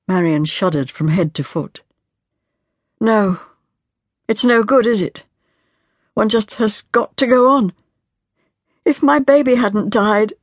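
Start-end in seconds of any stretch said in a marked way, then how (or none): 3.0-10.3 higher, urgent, tormented wife pouring out grief; a wife breaking down as she pleads; higher voiced tormented grieving wife; anguished young wife. Marian's hopeless speech.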